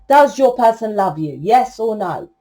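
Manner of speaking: ordinary delivery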